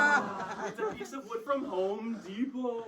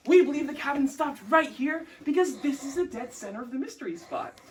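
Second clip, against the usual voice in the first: usual speaking voice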